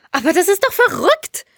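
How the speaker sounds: high-pitched indignant scolding. A narrator's reading. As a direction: scold them in exasperation. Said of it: "schimpfte sie".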